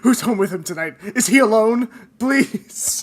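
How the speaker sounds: hammed-up frantic concern; mock worried parent. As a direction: plead in exaggerated parental panic. Mock worried parent pleading about him.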